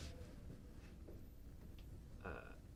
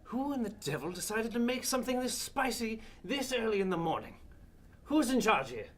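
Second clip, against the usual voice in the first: high, loud, outraged demand; indignant outburst; 13 semitones higher, 6 dB louder than usual. Acted. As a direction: bark it like an entitled noble. Angry customer complaining at breakfast.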